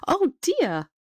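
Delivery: high, dismayed mother's exclamation; crying out. A narrator's reading. Mother sees her baby clawed by the cat, crying out in dismay. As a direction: exclaim in alarm, pitch leaping then falling. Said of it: "cried his mother".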